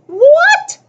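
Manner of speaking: loud, very high screech. A narrator's reading. Girl outraged at the boy's question, screeching. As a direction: shoot way up in a piercing, outraged question. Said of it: "she screeched".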